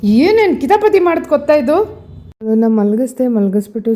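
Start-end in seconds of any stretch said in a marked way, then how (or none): 0.0-1.8 high, loud mock complaint; mock exasperation. Real, not acted. Mock complaint about toddler. (ಮಗಳ ಬಗ್ಗೆ ಎತ್ತರದ ತಮಾಷೆಯ ದೂರು)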